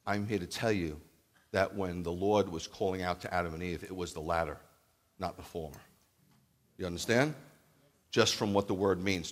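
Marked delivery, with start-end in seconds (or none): none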